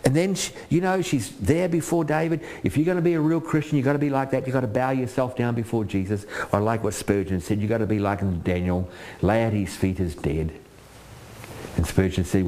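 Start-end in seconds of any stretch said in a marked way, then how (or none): none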